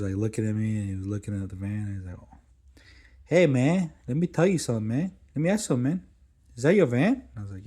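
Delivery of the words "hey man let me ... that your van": high, loud, eager hustler voice; pushy hustler dude. Retold or imitated. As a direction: lay on the street-hustler pitch, higher and louder. Pushy stranger in parking lot.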